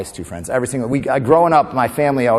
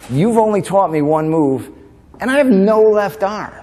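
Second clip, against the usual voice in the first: put-on pleading kid; 7 semitones higher, slower than usual. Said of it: "the kid's like".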